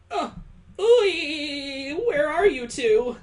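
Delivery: high, drawn-out distant hail; calling out. Acted. Friends in the distance calling for the two, high hail.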